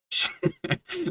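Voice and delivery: a sudden high squeal of laughter. Real, not acted. Reviewer recalling a funny scene, squealing with laughter.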